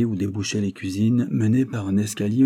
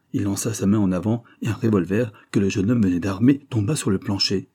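ordinary delivery, nothing marked

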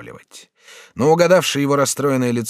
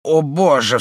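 frightened cry; 5 semitones higher, 5 dB louder, slower than usual. Acted. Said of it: "вскричала бедная женщина".

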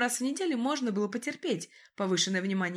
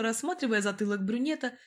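ordinary delivery

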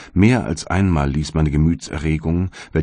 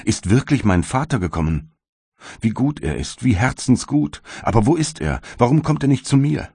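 höher und immer höher, freudig überschlagend; freudig erregt; 7 semitones higher than usual. A narrator's reading. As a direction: jubelnd vor Freude über den Vater. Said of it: "rief ich, während mein Gesicht sich verklärte". (freudig aufgeregt, steigend höher)

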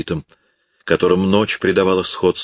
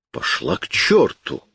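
a much higher, slow shouted curse; angry outcry; 9 semitones higher, slower than usual. Acted. Thénardier yells at Éponine, slow furious outcry. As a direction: fling the curse at her. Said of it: "вскричал Тенардье".

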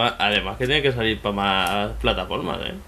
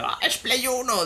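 much higher, slower mock-sneering voice; 11 semitones higher than usual. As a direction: lampoon the know-it-all critic. Mocking people who say it. (burla aguda y lenta imitando a un crítico)

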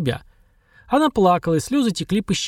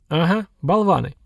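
a light, satisfied "Ага, болваны"; mildly pleased, agreeing with her; pleased agreement; 3 semitones higher than usual. A narrator's reading. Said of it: "довольно подтвердил Сергар". (Сергар довольно соглашается с Машей)

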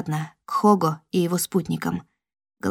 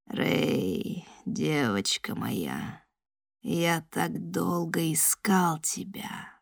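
ghostly whisper; 2 semitones lower, 9 dB quieter, much slower than usual. Acted. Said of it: "мой слух полоснул голос".

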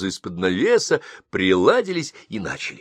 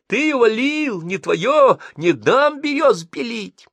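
much higher, slow frantic yelling; screaming like mad; 5 dB louder, slower than usual. Acted. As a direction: yell every word like a madwoman. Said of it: "как сумасшедшая орала она".

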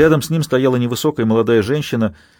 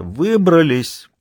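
through laughter; 6 semitones higher, much slower than usual. A narrator's reading. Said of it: "произнёс Дагоста сквозь смех".

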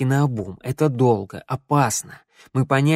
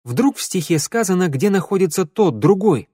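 higher, eager voice; excited guess; 7 semitones higher, 3 dB louder than usual. A narrator's reading. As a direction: float the idea with rising excitement. Excited idea about the song. (взволнованно, с надеждой)

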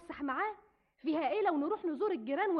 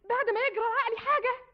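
raised, higher cry of despair; desperate outburst; 7 semitones higher, 5 dB louder than usual. Acted. Latifa protesting to her sister about the stepmother, raised and desperate. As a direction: push it out high and loud, near breaking.

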